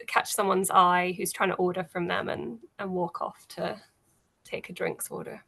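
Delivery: ordinary talk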